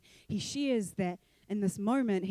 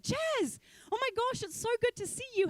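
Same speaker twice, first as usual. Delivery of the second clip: high, bubbly over-the-top greeting; imitating an overly sweet acquaintance, pitched way up; gushing girl. A church girl rushing over to greet Jazz, high and gushing.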